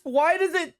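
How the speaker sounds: high, lisping, warped mic voice; glitchy mic lisp. Real, not acted. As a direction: voice bent high by a broken filter. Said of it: "I sound like Mike Tyson".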